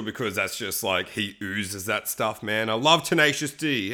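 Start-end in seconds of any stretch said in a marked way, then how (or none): none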